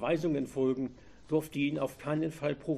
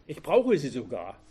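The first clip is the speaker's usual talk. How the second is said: a touch of cynical mockery, louder; 5 dB louder than usual. A narrator's reading. Robber mocking the helpful driver, cynically. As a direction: sneer the offer back. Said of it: "antwortete er zynisch".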